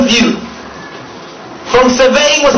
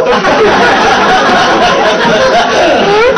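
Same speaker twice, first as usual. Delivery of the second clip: long high mocking laugh, rising; high cackling laugh; 11 semitones higher than usual. Real, not acted. Preacher mocking a survivor's quote, high cackle. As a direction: cackle at the joke you just told.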